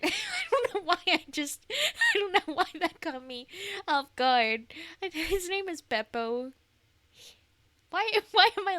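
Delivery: real laughter breaking through the words; giggling helplessly mid-sentence; laughing. Real, not acted. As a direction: caught off guard, laughing through it. Cracks up at the Italian bit.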